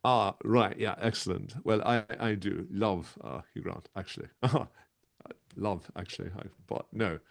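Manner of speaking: hesitant, stammering English charmer; bumbling romantic-lead impression; Hugh Grant. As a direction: dither politely, dropping away at phrase ends. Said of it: "what about a bit of Hugh Grant".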